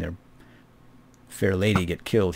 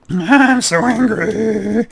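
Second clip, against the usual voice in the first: high, slow, laughing fake rage; drawn-out joking outburst, high and loud; mock angry; 11 semitones higher, 7 dB louder, much slower than usual. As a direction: ham up a silly tantrum through a laugh. Laughing mock tantrum at dragon.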